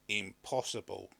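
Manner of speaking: plain reading voice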